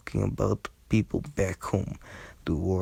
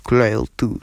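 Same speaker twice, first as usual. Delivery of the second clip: puzzled; 4 semitones higher, 9 dB louder than usual. A narrator's reading. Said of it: "Sam repeated, puzzled".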